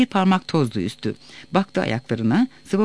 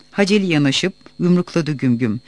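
ordinary delivery, nothing marked

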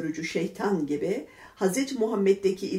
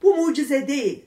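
loud, raised, indignant rebuttal; angry denial; 7 semitones higher, 7 dB louder than usual. A narrator's reading. Ebu Cehil rejects the stones' miracle, angrily. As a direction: throw it out as an accusation. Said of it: "öfkelendi".

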